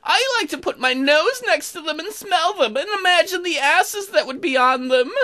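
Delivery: high-pitched, put-on character voice; odd character musing. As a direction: muse aloud in a high, odd little voice.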